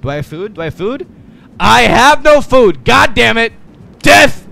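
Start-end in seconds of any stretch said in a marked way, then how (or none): 1.6-4.5 frustrated shout. Real, not acted. Frustrated outburst.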